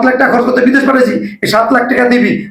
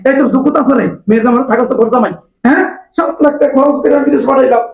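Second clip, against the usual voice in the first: ordinary talk, nothing marked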